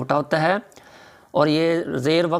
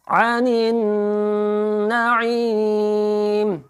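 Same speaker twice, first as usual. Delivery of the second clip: higher melodic chant, drawn out ending; तजवीद से तिलावत; 7 semitones higher, much slower than usual. Shown as a demonstration. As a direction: recite slowly, stretch the ई in नईम. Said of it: "आइन को हलक के दरमियान से". (सुरीली तिलावत)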